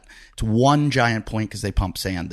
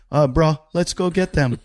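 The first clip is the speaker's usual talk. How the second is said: lightly put-on Hawaiian bro voice, higher; Hawaiian local; 5 semitones higher than usual. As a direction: imitate a laid-back Hawaiian surfer. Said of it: "weird Hawaiians that'll be like".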